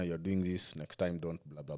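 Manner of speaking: ordinary delivery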